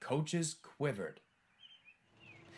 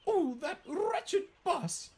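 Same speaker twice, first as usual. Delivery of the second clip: very high, slow, louder indignation; fussing; 5 dB louder, slower than usual. A narrator's reading. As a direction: fuss in shrieky outrage. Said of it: "fussed Annie".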